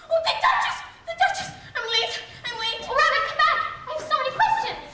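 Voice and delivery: breathless high exclamations; frantic calling. Acted. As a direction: cry out in a hurry. Rabbit runs, Alice calls after.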